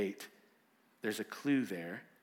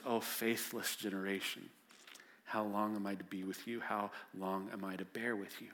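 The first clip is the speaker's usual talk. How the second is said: softer, lower sorrowful lament; quiet lament; 4 semitones lower, 5 dB quieter than usual. Acted. Jesus lamenting to his disciples, soft sorrowful lament. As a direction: sigh it out like a weary teacher. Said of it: "begins with a lament".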